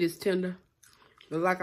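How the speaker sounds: chewing while talking; speaking between bites; mouth full. Real, not acted. Seen 0: Brings small fry to mouth and bites near 4.2–4.8s.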